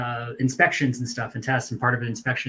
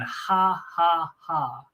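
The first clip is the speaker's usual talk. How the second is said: high, spaced-out fake "ha"s; forced mock laugh; 4 semitones higher, much slower than usual. Bunkmate laughing beside him as punishment, mock forced laugh. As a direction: imitate laughing on orders, held back. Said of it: "had to laugh like".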